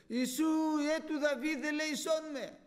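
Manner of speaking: high, loud desperate cry for mercy; Bartimaeus crying out. Acted. Blind Bartimaeus crying to Jesus for mercy, loud and high. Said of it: "Έτσι φώναζε ο Βαρτίμιος ο τυφλός".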